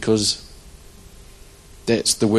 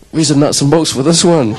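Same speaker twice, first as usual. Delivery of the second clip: mock exclaim; 5 semitones higher, 6 dB louder than usual. Loud worship, quoting himself.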